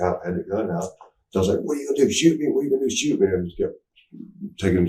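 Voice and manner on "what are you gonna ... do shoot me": high-pitched retold taunt; repeated mock challenge, pitched up; pissed-off dare. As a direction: dare the cop, acted out. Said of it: "I'm still pissed off".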